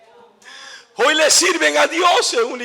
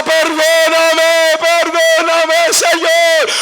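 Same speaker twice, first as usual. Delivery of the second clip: loud, high, drawn-out cry for forgiveness; imitated shouted plea; 5 semitones higher, 7 dB louder, much slower than usual. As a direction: act out a man screaming for pardon, stretching each word. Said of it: "comenzó a gritar y gritaba".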